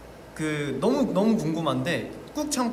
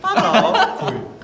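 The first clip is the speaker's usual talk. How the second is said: silly Psyduck voice; 8 dB louder, much slower than usual. Pretend. Joking with sticker on forehead.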